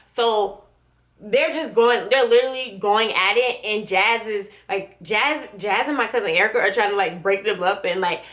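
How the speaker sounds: usual speaking voice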